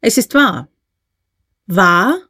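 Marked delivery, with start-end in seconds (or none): 1.7-2.2 louder questioning cry, rising at the end; startled exclamation. A narrator's reading. Uncle echoes his nephew's admission, startled exclamation. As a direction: call it back in disbelief. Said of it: "rief der Onkel".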